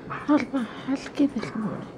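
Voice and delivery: high, squeaky cooing question; high-pitched doggy voice. Real, not acted. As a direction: push the coo up into a squeak. Repeated higher.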